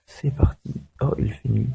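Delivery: whispered aside. Real, not acted. Quiet aside.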